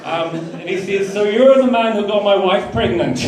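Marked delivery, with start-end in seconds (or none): 1.1-3.2 put-on loud, high comic Scottish accent; broad put-on Scottish, belted for the punchline; thick Scottish voice. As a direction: imitate the Scottish mate announcing big news. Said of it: "thick Scottish voice".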